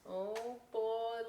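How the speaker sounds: plain reading voice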